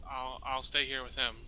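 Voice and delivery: normal talking voice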